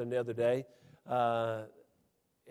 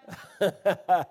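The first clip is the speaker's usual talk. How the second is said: burst of laughter; 6 semitones higher, 8 dB louder than usual. Real, not acted. Laughing at own story.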